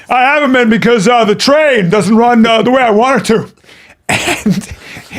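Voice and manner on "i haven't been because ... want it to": shouting. Retold or imitated. Jimmy answering about Long Island, mock shouting. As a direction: answer much too loudly, pitch up. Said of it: "fuck is he shouting for?".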